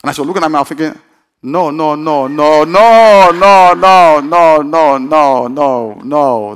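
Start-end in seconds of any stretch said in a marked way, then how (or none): 1.4-6.5 retold string of refusals swelling to a loud wail, then easing down; emphatic refusal. Preacher acting out his thoughts at the nameplate, emphatic refusal. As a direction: build to a big mock protest and subside.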